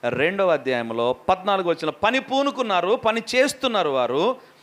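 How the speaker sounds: ordinary delivery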